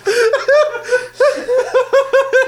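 loud high laugh; real loud laughter; loud laughing burst. Real, not acted.

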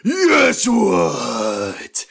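loud, drawn-out boom, starting high; booming demand. A narrator's reading. Chris demands respect from Danny, booming. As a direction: boom it to make someone jump. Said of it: "Chris' voice boomed and made Danny jump".

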